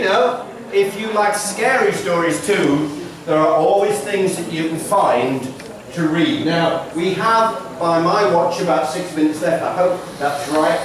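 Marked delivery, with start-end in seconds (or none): none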